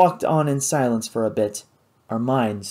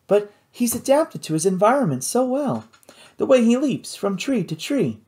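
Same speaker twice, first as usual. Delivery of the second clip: lightly voiced young woman, higher; higher, earnest girl's voice; 9 semitones higher than usual. A narrator's reading. Kate voiced in story.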